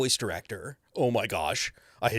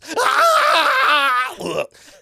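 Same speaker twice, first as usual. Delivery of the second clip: high loud drawn-out yell; longer falling scream; 11 dB louder than usual. Shown as a demonstration. Actor demonstrating a bigger cliff-fall scream. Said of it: "Depending on how large the cliff is".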